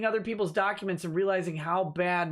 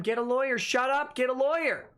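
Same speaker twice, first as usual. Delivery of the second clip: raised, higher, insistent; emphatic repeated advice; 7 semitones higher than usual. Retold or imitated. Host repeating his advice to chat, emphatic and raised. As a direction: hammer the advice home to the viewers. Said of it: "something I've been saying the entire time".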